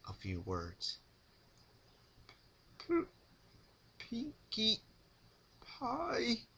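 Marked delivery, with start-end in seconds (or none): 2.8-6.5 high, strained, stammering pony voice; mumbling Rarity. Acted. Rarity, throat raw from screaming, mumbles Pinkie's name weakly. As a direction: stammer it out through a dry throat. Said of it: "Rarity managed to mumble".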